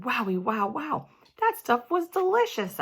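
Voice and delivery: surprised happy exclaim; amazed delight. Acted. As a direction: gush with shocked delight. Amazed at tasty pie.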